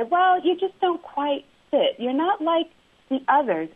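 imitated manager, pitched well above own voice; high-pitched, veiled criticism in a quoted voice; indirect put-down. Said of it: "in a way that wasn't direct".

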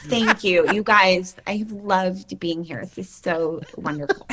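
sweet lisping thank-you, unhurried; Drew Barrymore lisp. Shown as a demonstration. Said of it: "show me".

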